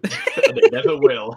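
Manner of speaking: high, loud laughing retort. Real, not acted. Friend teasing about the unrecorded game, laughing loudly. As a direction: laugh right through the words, slowly.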